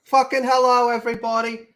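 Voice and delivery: loud high hello to the chat; big loud greeting. Real, not acted. Streamer greeting viewers with a loud hello. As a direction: boom the hello out to everyone.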